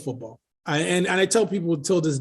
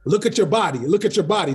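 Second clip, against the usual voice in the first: put-on Trinidadian accent; 2 semitones higher than usual. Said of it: "Man, I'm Trinidadian".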